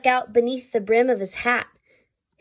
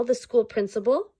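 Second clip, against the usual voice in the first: ordinary delivery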